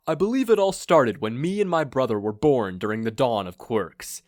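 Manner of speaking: grandiose villain. Acted. Villain gloats to his blindfolded captive, pompous and loud. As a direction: boom out a self-important evil plan.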